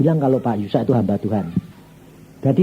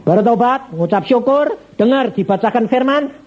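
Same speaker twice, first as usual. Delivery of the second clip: high, emphatic list of orders; commanding instruction; 9 semitones higher, slower than usual. Retold or imitated. Preacher directing a dying man to repent, commanding. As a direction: call out each step firmly, pitched up.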